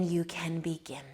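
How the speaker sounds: soft whispered cue; whispering. Acted. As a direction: breathe it out in a whisper. Whispered invitation.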